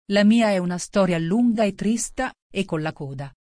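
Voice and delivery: plain reading voice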